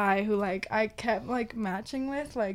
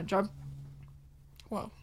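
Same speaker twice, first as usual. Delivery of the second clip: voice cutting out; 7 dB quieter than usual. Real, not acted. Drummer tells her band story, voice suddenly cutting out. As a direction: voice gives out mid-word. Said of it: "my voice just stopped working".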